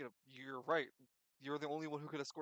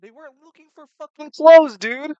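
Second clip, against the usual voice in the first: loud, high, fed-up yell; exasperated shout; 13 semitones higher, 30 dB louder than usual. Real, not acted. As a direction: shout it in disbelief. Heated out-of-character argument.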